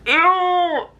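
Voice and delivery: high, sharp squeal of disgust. Real, not acted. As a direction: recoil with a high ew. Disgusted by the thought of eggs.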